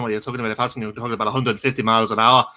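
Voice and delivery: rapid-fire Scottish patter, hardly a breath; Scottish accent at breakneck speed; fast Scottish accent. Shown as a demonstration. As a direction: rattle it off in Scots. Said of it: "a really fast Scottish accent".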